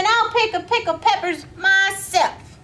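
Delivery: indignant Miss Billy. Acted. Miss Billy huffs, does it herself.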